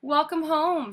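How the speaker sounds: bright, high welcome home; a mother welcoming her daughter home, cheerful. Acted. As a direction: greet your child gladly. Mother greets warmly.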